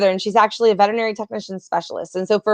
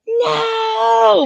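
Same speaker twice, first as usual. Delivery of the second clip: shouted no; 13 semitones higher than usual. Retold or imitated. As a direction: yelp it out in alarm. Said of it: "And you're like".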